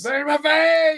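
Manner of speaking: joining in the teasing, a high mock shout; mock shriek. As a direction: shriek it like he did. Mocking the scared hiker.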